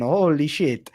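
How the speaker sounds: ordinary talk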